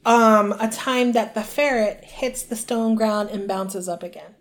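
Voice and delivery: ordinary talk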